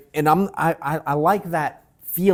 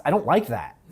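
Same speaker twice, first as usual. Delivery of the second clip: usual speaking voice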